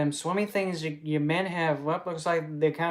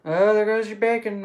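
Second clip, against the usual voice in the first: mock call-out; 6 semitones higher, 9 dB louder than usual. Calling out as pigs fall.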